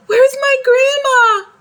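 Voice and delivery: high, loud cry of panic; child shouting for grandma at the burning house. A narrator's reading. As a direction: scream it through tears. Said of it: "I yelled".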